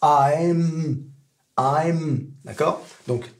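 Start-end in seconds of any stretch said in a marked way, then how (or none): 0.0-2.3 "I'm" drawn out into a sustained m; slow, stretched "I'm"; final M held. Shown as a demonstration. Teacher drilling the ending, held long on m. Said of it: "ça se termine par un M".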